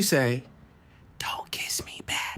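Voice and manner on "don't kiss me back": slow, breath-only whisper; secretive whisper. Retold or imitated. Little girl to playmate after the kiss, slow secret whisper. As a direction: whisper it slowly as a secret order.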